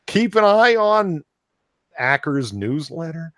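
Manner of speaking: high-pitched put-on activist voice; mock an earnest larper in a squeaky high pitch; neat larper voice. Host mocking an article he can't read normally, high larper voice. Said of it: "the neat larper voice".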